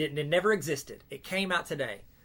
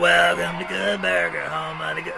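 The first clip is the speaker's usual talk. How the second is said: slow, louder Kel greeting; Kel impression; 2 semitones higher, 8 dB louder, slower than usual. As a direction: drag the welcome out, louder. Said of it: "Is that close?".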